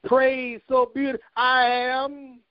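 high ringing preaching cadence. Real, not acted. Pastor praising church members, full preaching cadence. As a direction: preach it out, lifting each line.

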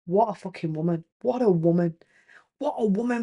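very soft, hushed admiration; quiet private awe; awed inner thought. Retold or imitated. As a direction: marvel under your breath. Recalling her own admiring thought.

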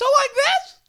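high cartoon mouse squeak; raspy Mickey Mouse. Shown as a demonstration. Host testing a [name] impression, high raspy mouse voice. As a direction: try out a raspy mouse falsetto. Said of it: "like a raspy Mickey Mouse".

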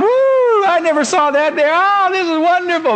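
very high, delighted hammed-up wonder; gushing new believer. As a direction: gush like a wide-eyed beginner. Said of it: "Reading your Bible, going".

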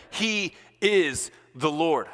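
slow, measured declaration; emphatic. Real, not acted. Preacher repeating the refrain, slow and emphatic. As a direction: weigh every word.

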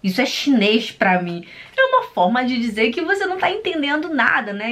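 normal talking voice

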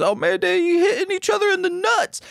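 high, fast goofy Stryker voice; high fast mock commentator; mimicking Stryker. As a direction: squeak it out high and quick like the commentator. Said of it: "but then Stryker says".

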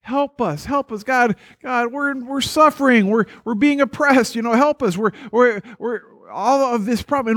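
high, urgent acted plea for help; desperate prayer, acted out; crying out to God. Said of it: "they cry out to God".

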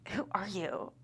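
breathy laughing whisper; whispered tease. Acted. Friend teasing Jordan, whispering and laughing. Friend mocking the diva talk.

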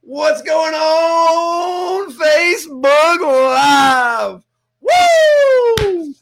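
very high, slow, stretched-out shouted greeting with a whoop; hype shout. Acted. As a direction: belt it out like an arena announcer. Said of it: "Hope you turn your speakers down or crank 'em up".

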